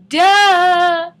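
loud, high, drawn-out mock reply; exaggerated duh. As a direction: stretch it out loud and goofy.

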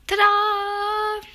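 sung high, loud reveal. Pretend. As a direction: announce it like a magic trick. Final reveal of the room.

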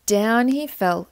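usual speaking voice

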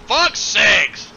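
loud frustrated outburst; exasperated shout. Pretend. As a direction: yell it in exasperation. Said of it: "Luke Skywalker throws in the ring".

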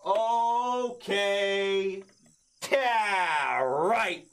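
ordinary talk, nothing marked

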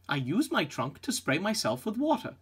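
ordinary talk

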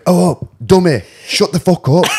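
high, loud mock heckling; imitated angry ranting. Storyteller mimics Joe ranting in the cells, high loud mock anger. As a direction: rip into them, mimicking a furious mate. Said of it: "him just ripping into everyone".

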